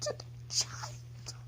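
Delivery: real tearful breakdown, high and slow; crying. Real, not acted. Breaking down crying while telling it.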